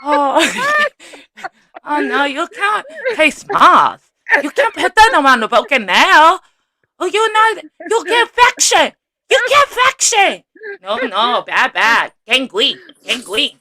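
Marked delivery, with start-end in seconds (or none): 1.8-13.6 loud, hammed-up Chinese-accented nail tech; mock Chinese accent. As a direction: scold a client in a put-on Chinese accent. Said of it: "she does a perfect Chinese person".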